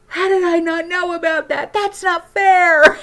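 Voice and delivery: hammed-up indignant husband; mock outrage. Wife imitating husband amazed by the trick, laughing mock outrage. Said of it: "He's just like".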